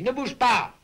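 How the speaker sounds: sudden high, loud command; sharp order. Acted. Father coaching his son to stay still, sharp order.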